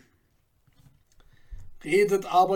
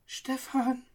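soft, hesitant, higher whisper of his name; timid whisper; 6 semitones higher, 7 dB quieter than usual. A narrator's reading. Girl answers Stefan, whispering timidly. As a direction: breathe the name, scarcely daring. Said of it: "flüsterte sie zagend".

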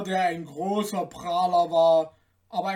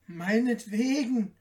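higher little tailor voice; high, grudging little man; tailor, resigned; 4 semitones higher than usual. A narrator's reading. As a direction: agree in a small, high voice. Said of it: "antwortete der Schneider".

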